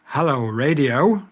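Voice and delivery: ordinary delivery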